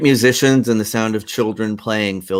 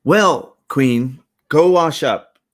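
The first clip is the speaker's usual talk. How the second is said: put-on loud, raised-pitch order to a child; parental command; 7 semitones higher, 8 dB louder, much slower than usual. Parent sending Queenie to wash up, loud and firm. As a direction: send the child off with firm volume.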